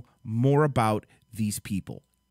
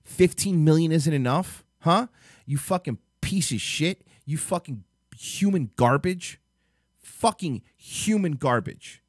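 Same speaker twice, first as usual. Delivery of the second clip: real outraged rant, stressed insults; angry tirade; 3 semitones higher than usual. Real, not acted. Vlogger berating a father for exploiting his daughter, angry tirade.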